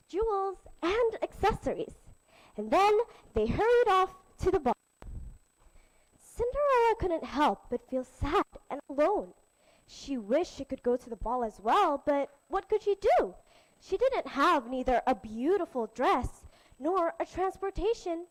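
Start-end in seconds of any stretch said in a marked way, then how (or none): none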